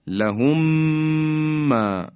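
لهم ما said as لَهُمّا with one long doubled م; merged lahumma. Shown as a demonstration. Tajwid teacher showing radio listeners the merged reading of لهم ما. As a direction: run لهم into ما on a held doubled م.

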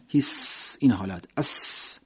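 slow "هیس" like hushing a class; hushing hiss. Shown as a demonstration. Teacher imitating hushing a classroom with a hiss. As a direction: hiss "اس" like shushing pupils. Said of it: "شبیه این که ما بگیم سر کلاس".

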